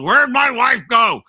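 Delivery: mock-cranky loud question; cranky husband. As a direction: demand it like an irritated spouse. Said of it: "her husband comes and goes".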